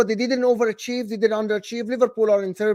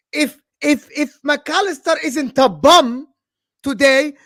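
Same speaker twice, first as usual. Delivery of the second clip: heated outburst; 6 semitones higher, 7 dB louder, slower than usual. Real, not acted. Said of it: "Why are you angry?".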